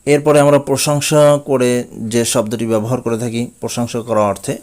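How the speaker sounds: ordinary talk, nothing marked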